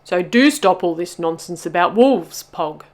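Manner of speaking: loud, exasperated telling-off; scolding. A narrator's reading. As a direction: scold the whiner firmly. Bubba keeps scolding Pog.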